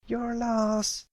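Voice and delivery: high filly voice, a mild retort; tomboy filly shout. Acted. Scootaloo retorting at Apple Bloom, mildly shouted high filly voice. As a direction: toss it back at her. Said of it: "Scootaloo shouted at her".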